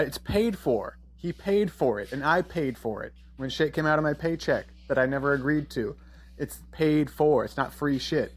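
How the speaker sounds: ordinary delivery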